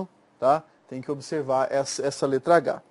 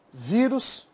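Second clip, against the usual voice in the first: ordinary delivery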